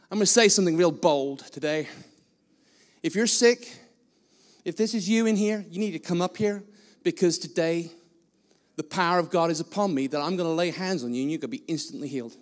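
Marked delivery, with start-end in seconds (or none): none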